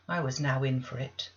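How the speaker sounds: usual speaking voice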